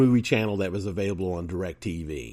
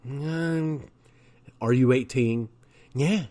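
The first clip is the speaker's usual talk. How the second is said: higher, slurred, vacant; a light put-on simple voice; mock dim-witted voice; 4 semitones higher, slower than usual. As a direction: play someone not all there.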